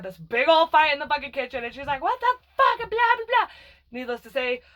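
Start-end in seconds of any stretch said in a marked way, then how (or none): 2.0-3.5 very high, loud retold outburst; sister yelling. Older sister fighting with her in the kitchen, angry yell.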